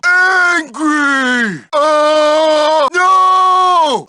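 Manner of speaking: loud, drawn-out angry bellow; brute tantrum. Acted. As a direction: howl it out in protest. Bully in a tantrum.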